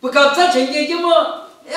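usual speaking voice

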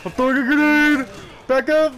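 loud, high panicked yell; grenade warning yell. Real, not acted. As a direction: yell to clear the blast. Warning teammate in game.

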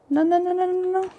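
rapid high string of no's, kept soft; small high panicky stammer; panicked. Real, not acted. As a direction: squeak out repeated no's in alarm. Panics as character slips.

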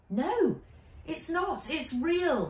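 put-on high, insistent girl; earnest young girl. Nat serious.

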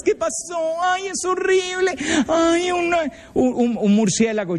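put-on high, whiny girlish panic; imitating a scared girl telling about the bat; mock frightened girl. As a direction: stammer it out like a terrified teenage girl.

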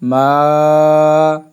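"মা" with a long, level held vowel; tarikan satu alif. Shown as a demonstration. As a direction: hold the vowel of "মা" on one note. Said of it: "এক আলিফ".

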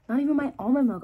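excited. Real, not acted. Excited about the taste.

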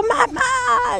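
put-on high frightened cry of a child; screaming for mom. Girl in a nightmare screaming for her mother, high and frightened. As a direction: call out for mom in terror from a nightmare.

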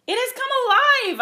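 high, loud cry of terror; gasped alarm. A narrator's reading. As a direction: cry out in shock at a monster. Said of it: "I heard him gasp".

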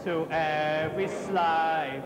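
"slave" sung low and slow as "slaive"; Cockney vowel. Shown as a demonstration. Chorus master showing the choir the vowel, "slave" as "slaive". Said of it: "a slight slave rather than slave".

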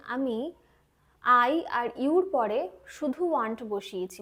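ordinary delivery

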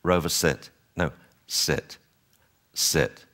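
soft, even commands; calm and consistent. Shown as a demonstration. Trainer showing owners a soft, even calm command. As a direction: give each command quietly and evenly. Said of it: "be calm and consistent".

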